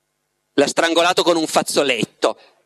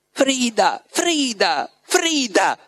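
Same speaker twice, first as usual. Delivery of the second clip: insistent pleading cry; 7 semitones higher, much slower than usual. A narrator's reading. Frida begging Margherita for help, insistent pleading. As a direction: beg for attention, urgent but held back. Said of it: "le gridò nell'orecchio una voce insistente, supplichevole".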